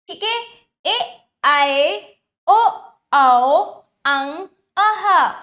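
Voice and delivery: put-on each vowel called out clearly for children; teacher reciting vowels. Shown as a demonstration. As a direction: model every vowel loud and distinct for the class. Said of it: "अब मेरे साथ बोलिए".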